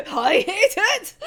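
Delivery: high laughing wail of i hate it; laughing despair. Real, not acted. Reading a sad panel, laughing high in mock despair.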